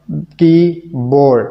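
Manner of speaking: "कीबोर्ड" slowly as की, pause, बोर्ड; syllable by syllable. Shown as a demonstration. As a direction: stretch both halves of "कीबोर्ड". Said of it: "ये वो तरीका है".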